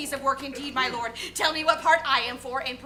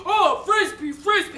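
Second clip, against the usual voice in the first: monstrous voice; 3 semitones higher, 4 dB louder than usual. Acted. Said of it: "I'll speak in a terrible, monstrous voice".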